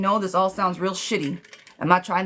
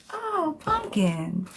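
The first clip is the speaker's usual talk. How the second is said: cooing fondly; 9 semitones higher, much slower than usual. Real, not acted. Cooing at a viewer's comment.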